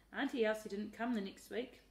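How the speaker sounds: ordinary delivery, nothing marked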